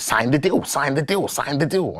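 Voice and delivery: high, repetitive gremlin imitation. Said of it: "like, a gremlin".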